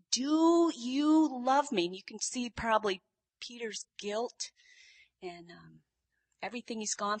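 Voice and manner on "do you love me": slow, high and louder, stretching "do you"; insistent repeated question. Retold or imitated. Jesus asking Peter yet again, slow and insistent. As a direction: press the question, drawing out each word.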